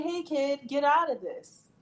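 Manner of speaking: lightly acted urgent plea, slowing at the end; imagined urgent warning. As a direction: act out telling a kid to leave. Voicing what parents should say.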